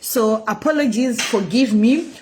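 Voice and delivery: ordinary talk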